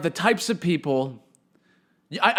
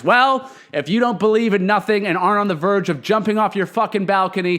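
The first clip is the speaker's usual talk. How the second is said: ordinary delivery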